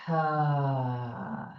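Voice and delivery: breathy exhale sigh, unforced; soft sigh. Shown as a demonstration. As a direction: let the air go on a gentle sigh. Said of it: "With a sigh".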